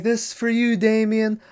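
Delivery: high, eerie farewell; a hint of a doomed nanny; lightly voiced nanny. Nanny calling down to Damien before she dies, lightly voiced. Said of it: "she's like".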